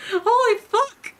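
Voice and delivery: slow, high, loud cry of shock; shocked outburst. Acted. Reacting to Jacqueline killing him, shocked high cry. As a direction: draw it out in high-pitched horror.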